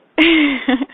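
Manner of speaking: loud burst of laughter; delighted laugh. Real, not acted. Laughs at husband's reply.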